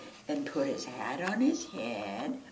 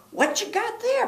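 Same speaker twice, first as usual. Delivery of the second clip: high animal voice; 10 dB louder than usual. Acted. Nutria voice.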